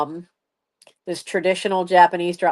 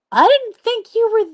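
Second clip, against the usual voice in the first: startled blurt. Retold or imitated. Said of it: "And she goes".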